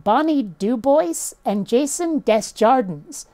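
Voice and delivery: put-on "Dubois" as Doo-boyce, "Desjardins" as Dess-jardins; Anglicized names. Shown as a demonstration. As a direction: slowly say "Dubois" and "Desjardins" as if English. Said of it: "nobody pronounces anything French-like".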